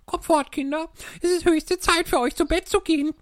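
very high, loud chirping bird voice, a touch of fear; a little bird hurrying her chicks away; canary calling her young home in alarm; cheeping tremulously. A narrator's reading. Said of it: "piepte zitternd".

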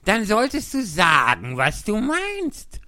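high, loud and slow, the last words stretched; squeaky insistent hare voice; excited March Hare. A narrator's reading.

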